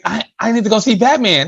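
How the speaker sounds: put-on high eager kid voice; little boy begging his dad, high; kid pleading. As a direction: beg dad like a small boy. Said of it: "I was like".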